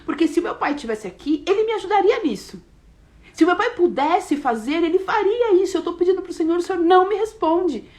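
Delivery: lightly voiced hurt, complaining plea; pleading prayer. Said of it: "a minha oração frequente, gente, era assim".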